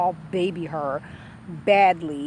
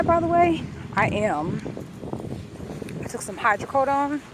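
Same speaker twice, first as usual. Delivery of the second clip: ordinary delivery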